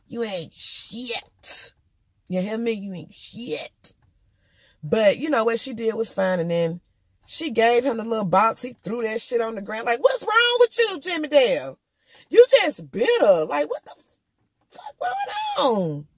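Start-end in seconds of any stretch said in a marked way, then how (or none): 0.1-3.9 very slow, softer, drawn-out contempt; more dramatic put-down. Shown as a demonstration. Showing how the aunt should have told off her ex, slow dramatic contempt. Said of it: "I wish she coulda did a little bit more dramatic". 10.0-16.1 much higher, louder, incredulous outcry; outraged. Acted. The aunt yells at her ex for throwing the box, outraged.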